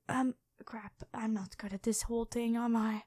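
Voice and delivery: breathy whisper to herself; whispered aside. Acted. Dragon whispering to herself as her act slips, a panicked aside. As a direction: mutter it under your breath, caught out.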